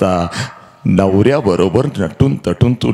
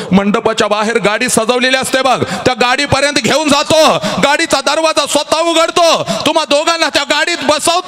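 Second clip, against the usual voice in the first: 1 full-force preaching; faster than usual. Acted. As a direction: drive it like a kirtan peak.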